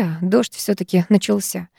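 plain reading voice

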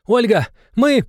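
high, loud, drawn-out cry; urgent call. A narrator's reading. Countess calling to the baroness mid-battle, urgent cry.

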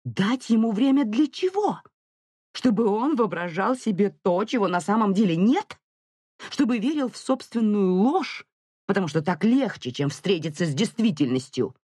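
high, heated rhetorical questions; incensed wife's retort; indignant challenge. A narrator's reading. Mother challenges husband's plea.

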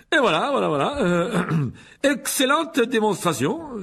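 high, loud, stumbling with throat-clearing; flustered showman. A narrator's reading. Lockhart climbs back after his fall, flustered and loud. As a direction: cover embarrassment with forced bluster. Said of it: "d'un pas mal assuré".

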